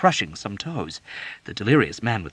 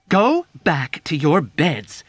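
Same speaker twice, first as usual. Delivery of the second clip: slow, louder and higher plea; pleading; 6 semitones higher, 7 dB louder, slower than usual. A narrator's reading. Nurse Duckett pleading with Dunbar and Yossarian. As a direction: plead with them to go back. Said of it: "Nurse Duckett pleaded".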